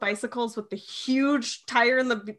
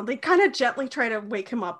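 usual speaking voice

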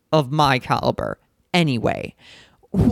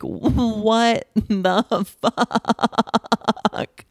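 high, drawn-out mock disbelief; stunned disbelief; 6 semitones higher, much slower than usual. Storyteller reacting to the sleep-peeing, drawn-out disbelief. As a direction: stretch the last word out in astonishment.

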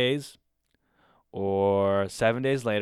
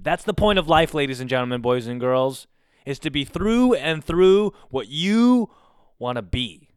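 mock sermon; 3 semitones higher, 8 dB louder than usual. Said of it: "I'm a fucking philosopher".

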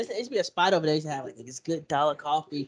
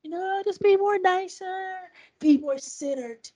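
high, slow, syrupy counsellor voice; therapist's soothing advice; 12 semitones higher than usual. Retold or imitated. Therapist tells him to be nicer, in a high slow syrupy voice. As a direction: mimic a gentle therapist, slowly. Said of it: "She like".

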